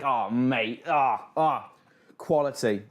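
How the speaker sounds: normal talking voice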